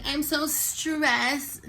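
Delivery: high, very slow, stretched whine; imitating the friend's overdone stress; mock whine. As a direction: ham up her fake stress. Mocking friend's complaint.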